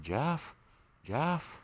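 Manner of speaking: high, soft mock call; imitated name call. Repeating how she called his name, high mock call. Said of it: "She just said".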